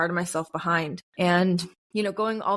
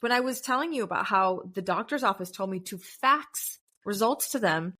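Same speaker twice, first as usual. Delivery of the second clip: ordinary talk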